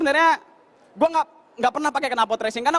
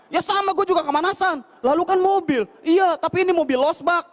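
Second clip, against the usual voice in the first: higher voiced comeback, slowing at the end; annoyed driver retort; 5 semitones higher than usual. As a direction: snap back that he's hot too. Driver answers back.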